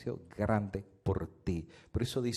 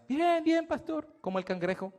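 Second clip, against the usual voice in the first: voz de hermana muy aguda, más fuerte al principio; 5 dB louder than usual. Retold or imitated. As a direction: imita a la hermana con voz alta y aguda. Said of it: "Y me decía". (la hermana le contesta al pastor con voz muy aguda)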